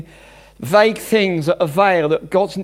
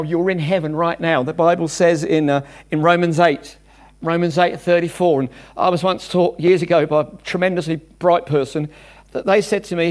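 ordinary talk

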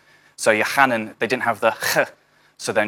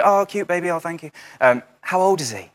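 gushing stranger; 8 semitones higher than usual. Retold or imitated. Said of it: "they'll say".

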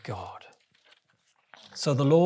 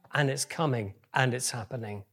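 normal talking voice

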